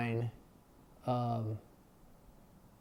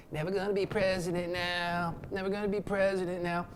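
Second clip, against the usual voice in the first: lightly voiced sing-song dandy, a bit higher; mocking the flamboyant Jefferson; 4 semitones higher than usual. Jefferson in his purple outfit sulking, a mild mock dandy voice. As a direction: tease him as a prancing fop who sulks. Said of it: "Jefferson, you know, the dandy, flamboyant".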